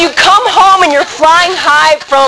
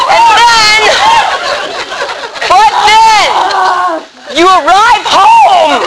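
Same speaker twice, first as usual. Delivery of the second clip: dramatic build-up; 9 semitones higher, slower than usual. Acted. Telling retreat group about coming home, high dramatic build. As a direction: build suspense, then land it.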